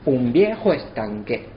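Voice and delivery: Un viejo estanque slowed, vowels joined across words; with synalepha. Shown as a demonstration. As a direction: glide the vowels between words together. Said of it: "La sinalefa es la pronunciación".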